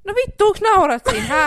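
high, loud fake indignation; No vittu, onks naurat siinä shouted in mock anger; mock outrage. Real, not acted. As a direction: pretend to be offended. Mock outrage at laughing friend.

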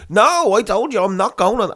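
high, loud protest, acted out; retold angry refusal. Passenger refuses the Amsterdam flight, retold indignant outburst. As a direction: re-enact digging your heels in, voice jumping up. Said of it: "I fucking seen red".

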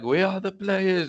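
high, drawn-out exasperated call; mock yell at the players, stretched out; retold shouting. Fan calling out to his team through the TV, retold shout. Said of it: "I was shouting at my T V".

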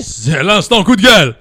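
loud, put-on segment announcement; shouted jingle. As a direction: belt it out as a show jingle. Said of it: "Jingle, jingle, jingle". (jingle crié à pleine voix)

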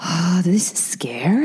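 slow hushed mock-fearful whisper; half-joking whispered dread; scared whisper. Real, not acted. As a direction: whisper it like a ghost story is coming. Bracing for dark nursery rhyme.

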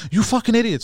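mildly heated, indignant retelling; retold anger. Friend telling how he confronted the phone thief, mock-angry. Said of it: "So I start getting mad at him".

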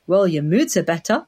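ordinary delivery

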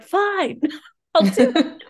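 giddy mock defeat; laughing surrender. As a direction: give in through laughter. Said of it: "And I was like".

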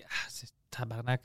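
very soft curse to oneself; muttered swearing. Retold or imitated. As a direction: let the swear slip out under your breath. Acts out swearing to himself. (juron marmonné tout bas)

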